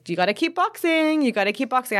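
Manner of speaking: egging you on like a coach; lightly voiced coaching mentor; quoting him. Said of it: "He says".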